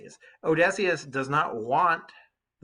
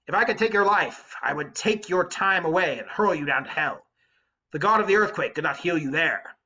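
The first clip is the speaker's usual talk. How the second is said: louder, a fierce shout of defiance; shouted threat; 5 semitones higher, 6 dB louder than usual. Acted. Odysseus answers the Cyclops with a loud angry shout. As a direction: throw the threat back at the giant. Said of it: "I shouted in reply to him".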